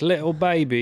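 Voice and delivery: put-on British lilt; imitated British accent. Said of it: "she goes British first".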